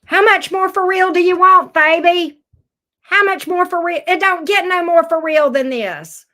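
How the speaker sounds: loud, high, pressing delivery; emphatic insistence. Real, not acted. Reader pressing Scorpio that it's for real, loudly insistent. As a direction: push it out loud like an excited exhorter.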